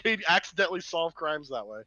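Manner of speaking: ordinary delivery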